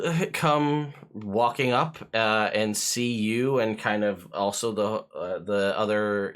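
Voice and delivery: ordinary delivery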